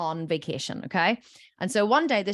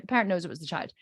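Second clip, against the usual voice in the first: ordinary delivery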